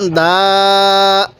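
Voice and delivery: দা with its vowel held long; one-alif elongation. Shown as a demonstration. Teacher showing one alif of pull, দা drawn out. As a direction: stretch দা on one steady note. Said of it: "এক আলিফ টান দিব".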